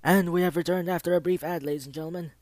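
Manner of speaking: loud host announcement; announcer voice. Acted. Host announcing return from break.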